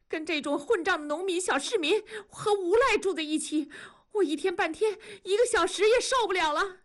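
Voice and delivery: furious through her tears, demanding they move out; tearful anger. Acted. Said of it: "文丽说着就开始流泪". (妻子含泪发怒，逼丈夫搬家)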